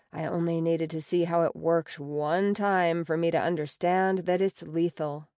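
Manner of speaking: plain reading voice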